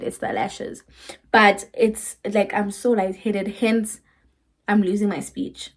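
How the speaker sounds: ordinary talk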